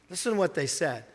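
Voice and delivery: ordinary delivery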